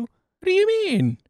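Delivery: high, loud, drawn-out protest; slow incredulous squawk of objection; indignant disbelief. Real, not acted. Mock quarrel over trying on shoes.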